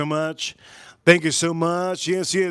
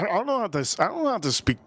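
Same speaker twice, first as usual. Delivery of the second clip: ordinary talk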